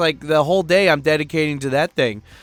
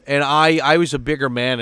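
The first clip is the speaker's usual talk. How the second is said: ordinary delivery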